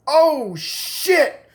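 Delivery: high, drawn-out "oh shit"; horrified shock. Retold or imitated. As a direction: wail it as the dread hits. Realizes he may be infected too.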